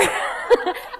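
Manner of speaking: breathy high laugh; unvoiced laugh after the joke; real laugh. Real, not acted. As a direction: burst out laughing. Laughing at her own joke.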